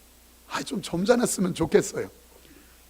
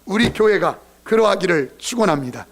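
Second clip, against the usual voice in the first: preaching at full force, loud; 4 semitones higher, 7 dB louder than usual. Acted. Pastor blessing the congregation, preaching loud. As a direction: drive the blessing home from the pulpit.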